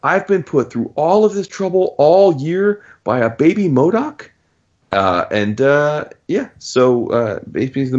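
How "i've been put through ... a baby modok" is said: mock flip-out. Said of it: "She's like".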